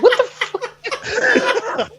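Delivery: very high, stretched-out disbelief; hammed-up baffled outburst, voice way up; incredulous reaction. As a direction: squeal it slowly through laughter. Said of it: "I'm like".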